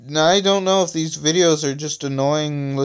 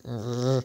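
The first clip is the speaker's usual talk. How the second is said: soft "grr" under the breath; quiet, irritated growl; angry grumbled growl; 6 dB quieter than usual. A narrator's reading. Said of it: "mumbled Poet angrily".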